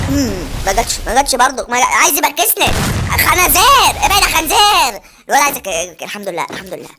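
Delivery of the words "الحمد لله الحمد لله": relieved murmur. Real, not acted. Relieved murmur after close call.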